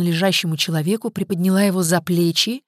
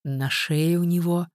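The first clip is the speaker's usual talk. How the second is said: usual speaking voice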